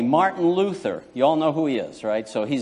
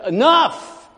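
sudden high shout; thundering preacher's cry; loud preaching; 10 semitones higher, 5 dB louder than usual. Acted. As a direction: bellow it from the pulpit. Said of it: "I know I'm preaching a little loud here".

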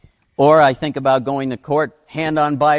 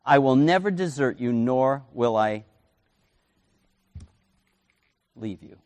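tender promise; 3 semitones lower than usual. Shown as a demonstration. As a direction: let the promise sink in gently. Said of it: "because he himself has said".

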